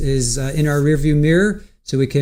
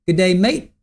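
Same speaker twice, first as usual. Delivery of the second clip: badly faked Australian "mate" greeting, pitched up; bad Australian accent; 4 semitones higher than usual. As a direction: attempt a hammy Aussie accent. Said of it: "that was probably a really bad accent".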